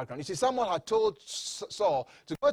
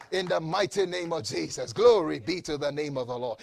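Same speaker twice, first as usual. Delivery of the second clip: ordinary delivery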